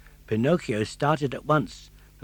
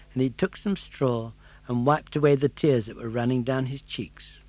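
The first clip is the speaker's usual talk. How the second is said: plain reading voice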